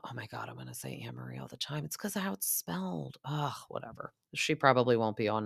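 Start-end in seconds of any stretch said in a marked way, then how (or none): 0.0-4.1 whispered aside. Real, not acted. Whispered aside fixing her mistake.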